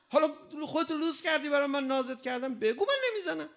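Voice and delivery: high, rising to a loud mock scold; mother scolding her fussing child, loud and high. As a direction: play a mother telling off a crying child.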